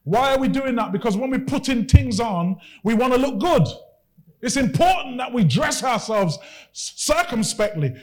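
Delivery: usual speaking voice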